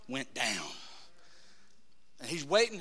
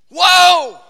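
loud, high "whoa" of amazement; big astonished shout; exclaimed whoa; 8 semitones higher, 17 dB louder than usual. Acted. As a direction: burst out in awe, high and loud. Reacting in awe, acted.

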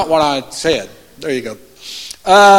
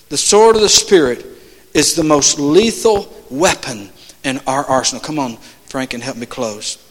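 usual speaking voice

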